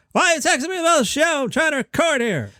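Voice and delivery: very high-pitched, frustrated mock rant; angry Jerry Seinfeld. Shown as a demonstration. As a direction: rattle it out high and exasperated. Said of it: "angry Jerry Seinfeld".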